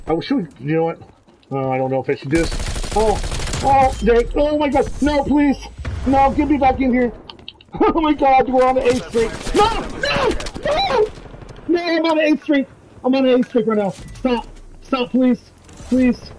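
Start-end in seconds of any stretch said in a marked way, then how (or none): 3.0-7.1 panicked pleading. Real, not acted. Begging teammate not to blow him. 7.8-16.3 very high squealing panic; frantic excitement. Real, not acted. Streak climbing, panic begging.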